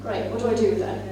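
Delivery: ordinary delivery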